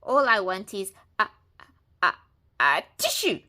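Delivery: wolf about to sneeze; sneeze building. A narrator's reading. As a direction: let the sneeze build on each a.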